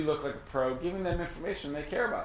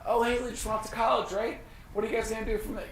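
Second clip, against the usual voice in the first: much higher, bright chummy phone greeting; friendly check-in call; 8 semitones higher than usual. Pretend. Agent phoning past client Mark, cheery and much higher. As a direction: play the upbeat buddy calling an old client. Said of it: "I'm gonna reach out to Mark".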